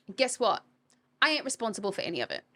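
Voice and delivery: usual speaking voice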